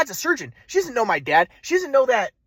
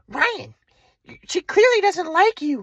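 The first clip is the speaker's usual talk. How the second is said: high, slow mocking mother voice; mom's voice; 9 semitones higher, slower than usual. Son mocking his mother's dismissal, high and slow. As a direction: drawl it high like the cold mother. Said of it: "my mom's like".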